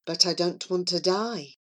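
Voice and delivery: soft, timid little protest; meek, subdued voice; small voice. A narrator's reading. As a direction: shrink down to a quiet plea. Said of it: "said Charlie in a small voice".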